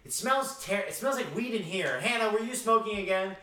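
high-pitched mock teen character; funny-voiced alter ego. Returning to the room to accuse Hannah of smoking, mock-suspicious. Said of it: "she's like".

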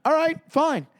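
faking giving in, with a plan; scheming calm. Retold or imitated. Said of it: "so I go".

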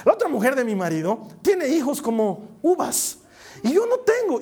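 high-pitched acted complaint; tearful lament. Anna telling God of her rival's children, tearful lament. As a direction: wail it like a hammed-up grievance. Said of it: "Y lloraba".

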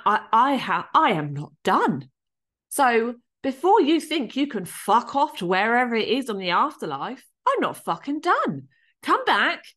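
retold furious demand, voice climbing high; raging. Daughter ordering her dead dad back, raging as retold. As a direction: relive the rage, hammering out each demand. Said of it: "I was raging".

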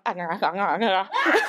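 laughing, hammed-up breathy ahs; mock moaning. Mimicking her noises during the make-out, laughing mock moans. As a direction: fake moans broken by laughter. Being clawed while making out.

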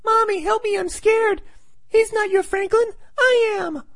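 put-on scared little boy in a very high squeaky voice; Franklin. Little boy begging Mommy for help, freaking out in a high mock child voice. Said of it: "Little boy Franklin is freaking out too".

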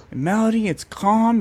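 ordinary delivery

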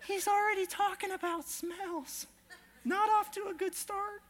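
high, teasing voice of a doubtful listener; mock critic. Preacher voicing the crowd's doubt, high and mock-critical. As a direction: poke fun at yourself as the crowd would. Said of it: "imagined congregation's thoughts".

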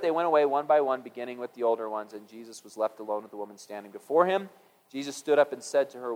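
ordinary talk

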